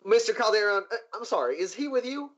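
lightly acted nervous underling; flustered guard. Guard barging in on his boss's poker game, flustered.

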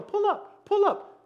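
high-pitched automated female alert; cockpit warning voice. Retold or imitated. Woman in pilot's ears warns him, automated alert. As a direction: repeat the alert evenly, pitched well up.

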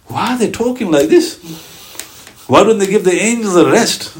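a hint of stunned disbelief; shocked companion. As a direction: sound mildly baffled by all the talking. Sahaba shocked by our chatter.

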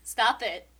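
normal talking voice

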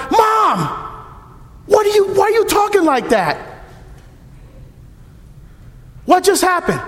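puzzled why mom talks so; baffled son. Acted.

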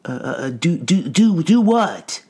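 plain reading voice

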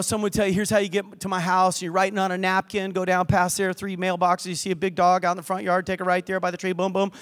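ordinary talk

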